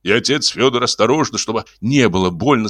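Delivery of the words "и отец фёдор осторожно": usual speaking voice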